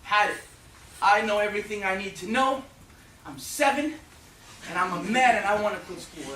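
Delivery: put-on loud, high declaration of a boy quitting school; defiant kid. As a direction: announce it like a stubborn seven-year-old. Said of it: "I said".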